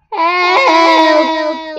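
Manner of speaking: high, loud cry for help. A narrator's reading. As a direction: yell it out, stuck on its back. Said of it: "it cried".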